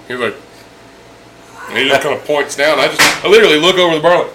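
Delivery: ordinary talk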